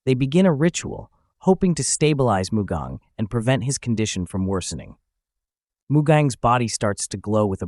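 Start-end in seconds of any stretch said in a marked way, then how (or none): none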